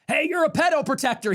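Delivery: high-pitched mock heckle; blue-collar heckler. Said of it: "some blue-collar hick lib".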